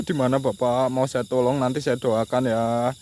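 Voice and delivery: loud call offering help; loud, earnest offer of prayers to a ghost. Real, not acted. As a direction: call out firmly so the spirit hears. Calling out to a spirit.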